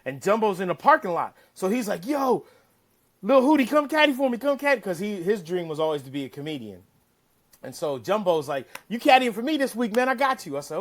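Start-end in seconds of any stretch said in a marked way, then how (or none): 2.0-4.8 calling out. Retold or imitated. Jumbo calling out to caddie. 8.9-10.6 higher, louder friendly call; a pal pumped up to hire you; hyped-up Jumbo voice. Retold or imitated.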